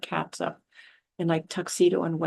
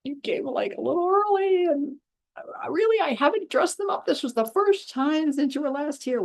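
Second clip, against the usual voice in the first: flustered; 7 semitones higher than usual. Retold or imitated. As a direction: caught out, high and stammering. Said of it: "she's all flustered".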